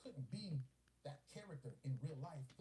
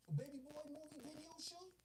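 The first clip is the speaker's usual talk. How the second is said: high-pitched mock disbelief; incredulous; 12 semitones higher than usual. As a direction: repeat it back in high, acted astonishment. Acted quarrel over the video.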